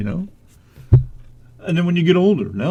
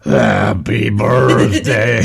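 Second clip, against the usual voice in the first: low, slow and loud mock sultry star; badly faked screen-siren voice; Marilyn Monroe; 5 semitones lower, 5 dB louder, much slower than usual. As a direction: ham up a drawn-out, low birthday serenade. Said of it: "your bestest Marilyn Monroe voice".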